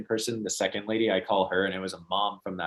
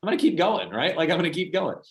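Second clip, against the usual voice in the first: high, louder fired-up promise; determined vow; 10 semitones higher, 4 dB louder than usual. Retold or imitated. Son to mom, vowing to keep going, fired up. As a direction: swear it like you mean it.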